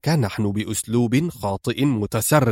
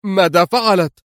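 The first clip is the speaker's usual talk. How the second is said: high, louder panicked question; alarmed cry; 7 semitones higher, 4 dB louder than usual. A narrator's reading. The lawyer pressing Gomani about the news: alarmed higher cry. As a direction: demand it in rising dread. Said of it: "هتف به آل وقد تضاعف ارتياعه".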